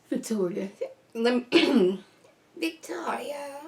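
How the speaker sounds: lightly voiced haughty grandma, drawn out; a hint of a cold, judging grandmother; evil grandma voice. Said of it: "my evil city grandma".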